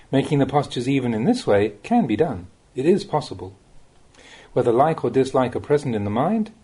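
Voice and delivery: normal talking voice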